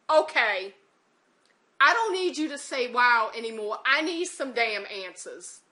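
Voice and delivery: retold sharp, loud insistence; fed-up demand. Caller demanding answers after repeated wows, fed-up and loud. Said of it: "talking to him like that".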